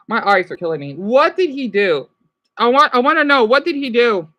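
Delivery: ordinary talk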